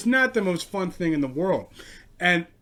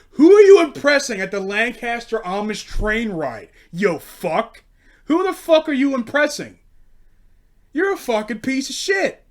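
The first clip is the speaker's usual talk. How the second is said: loud, higher furious tirade; angry rant; 7 semitones higher, 9 dB louder than usual. Real, not acted. Comedian ranting at the eager man, loud and furious. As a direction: tear into him.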